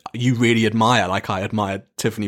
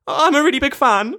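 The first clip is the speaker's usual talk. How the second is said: very high fan squeak; mock your own starstruck outburst, pitched way up; starstruck blurt; 3 dB louder than usual.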